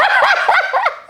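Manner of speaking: high, delighted burst of laughter; high-pitched cackling laugh; real laugh. Real, not acted. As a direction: crack up mid-story. Laughs at retold exchange.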